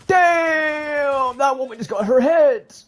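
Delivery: sudden high yell of disbelief; shocked shout. Real, not acted. Gamer shouting at a kill on screen, loud and high. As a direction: blurt it out at the top of your voice. Sudden reaction during ranked match.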